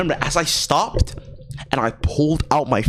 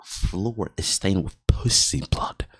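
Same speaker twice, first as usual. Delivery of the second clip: hushed whisper; 5 semitones lower, 7 dB quieter, slower than usual. Real, not acted. Dramatic reveal of stained floor.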